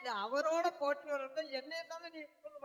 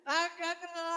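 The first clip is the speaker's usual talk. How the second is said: ordinary talk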